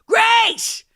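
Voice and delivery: high, loud shouts of a name; calling out. Retold or imitated. Mom calling down the street to her daughter, high, loud shouts. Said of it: "She was going".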